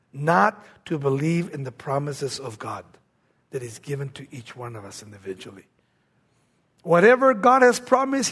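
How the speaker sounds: ordinary delivery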